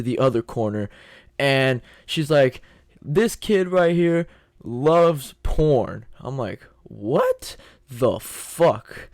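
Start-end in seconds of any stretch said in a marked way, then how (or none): 3.0-6.0 slow, drawn-out, higher-pitched announcement; teacher's mock announcement. Teacher announces him to the class. 6.8-9.1 very slow, drawn-out mock shock; long, dragged-out inner reaction; stunned disbelief. Stunned inner reaction to teacher's announcement.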